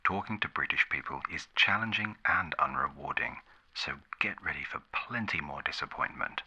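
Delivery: usual speaking voice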